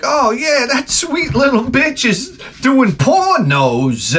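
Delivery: high-pitched, drawling pimp caricature. Acted. Pimp telling a father about his daughter, pimp voice. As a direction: stretch it out in a high put-on voice. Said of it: "he runs into some pimp and he's like".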